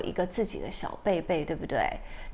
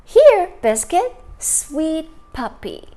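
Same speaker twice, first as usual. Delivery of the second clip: tender comfort; 6 semitones higher, 7 dB louder than usual. Acted. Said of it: "说什么".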